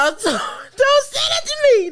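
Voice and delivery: high, tearful comic wail; mock pleading. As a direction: beg through laughter, voice shooting up. Comic begging to stop inbox messages.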